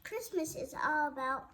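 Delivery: usual speaking voice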